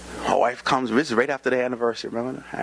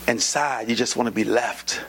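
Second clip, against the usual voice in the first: ordinary delivery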